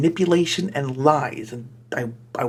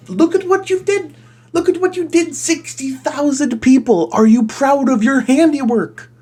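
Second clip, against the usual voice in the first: much higher, louder mock reproach; mock scolding; 10 semitones higher, 5 dB louder than usual. Reader scolds the fanfic author, high mock scolding. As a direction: scold like a shocked parent.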